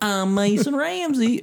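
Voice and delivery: put-on high, slow young boy voice; [name] impression. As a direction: talk like a high-pitched kid singer. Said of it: "I like your Mason impression".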